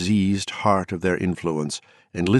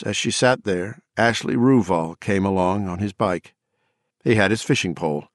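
plain reading voice

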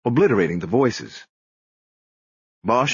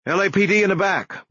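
high-pitched warning yell; 10 semitones higher than usual. A narrator's reading. Detective announcing himself to officers down the hall, high yell. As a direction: call out high to officers ahead. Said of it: "Bosch yelled".

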